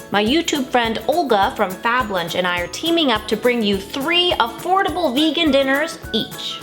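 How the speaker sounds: usual speaking voice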